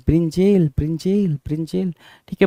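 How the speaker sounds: ब्रिंजेल repeated with a long "jayl"; misspelt reading. Shown as a demonstration. Teacher repeats the wrong option for students, ब्रिंजेल ending "jayl". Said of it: "और ये क्या कह रहा है भाई".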